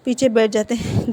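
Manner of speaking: ordinary delivery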